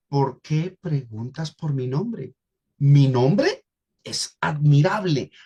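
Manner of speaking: slow question, pause, then "Mi nombre es admirable" falling; question then affirmation. Shown as a demonstration. Pastor shows the congregation the right reading, slow. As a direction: split the question from the statement. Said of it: "Ahí haces una afirmación".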